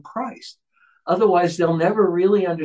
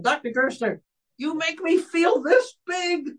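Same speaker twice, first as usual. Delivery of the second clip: very high, drawn-out mock female voice; upset woman's complaint. Woman complaining to the preacher after his sermon, high imitated voice. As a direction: squeak it out slowly as an imitated churchgoer. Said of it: "she says to Doctor Gerstner".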